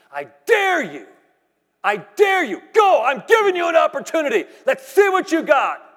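defiant shouted challenge to the devil; mocking dare. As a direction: taunt the devil, daring him. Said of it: "I mocked him for twenty minutes".

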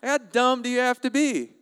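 high, incredulous outburst; mock disbelief. Preacher mocking foolishness to the congregation, mock disbelief. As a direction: pitch it way up, exasperated and baffled.

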